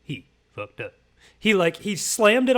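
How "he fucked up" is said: low, quiet documentary-style narration; hushed mock narrator line; meme narrator voice. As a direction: intone it like the meme caption. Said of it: "the meme where it's like".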